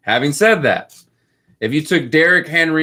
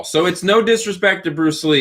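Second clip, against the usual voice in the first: ordinary delivery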